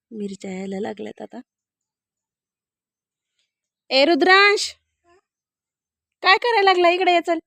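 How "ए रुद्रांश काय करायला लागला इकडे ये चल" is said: loud, high call across the yard. Real, not acted. Mother calling child down.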